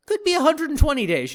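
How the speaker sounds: high and loud exasperation; exasperated exclamation. Real, not acted. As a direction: exclaim it in disbelief. Exasperated exaggeration.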